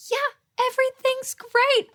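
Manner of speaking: high-pitched, over-the-top joy; acted joy. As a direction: burst out as Joy itself. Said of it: "when Joy comes in, it's like".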